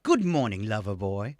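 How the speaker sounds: imitated British accent, dropping low at the end; sexy British voice. Original Alexa greets the user in the morning, sexy British voice. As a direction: put on a seductive British assistant's voice. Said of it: "they took away the sexy British voice".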